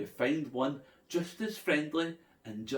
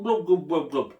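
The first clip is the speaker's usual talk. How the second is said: bubbly glubs; 2 semitones higher, 8 dB louder than usual. A narrator's reading. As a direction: make the glub sound like bubbles rising. Sound of swimming underwater.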